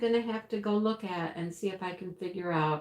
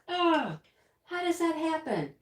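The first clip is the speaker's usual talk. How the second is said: higher, groaning question of disbelief; frustrated groan; 8 semitones higher than usual. Retold or imitated. Retelling to chat her annoyance, higher mock groan. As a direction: throw up your hands at the wrong drills. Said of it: "I'm just like".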